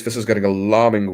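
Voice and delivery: usual speaking voice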